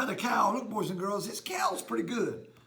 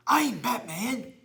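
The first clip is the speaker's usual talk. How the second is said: usual speaking voice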